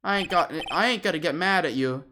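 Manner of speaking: gangster voice gone sweet; gruff boss charmed by a girl; fond mobster boss. Acted. Tiger softening.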